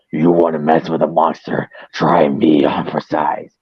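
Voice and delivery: low, growling fish-monster threat; growly monster voice. Retold or imitated. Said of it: "Every time I do a growly voice".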